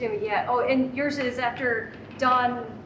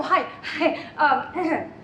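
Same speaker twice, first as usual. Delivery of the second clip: ordinary talk, nothing marked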